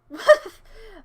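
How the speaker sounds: sudden loud, high laugh; burst of laughter. Real, not acted. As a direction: let a short laugh burst out. Bursts out after remark.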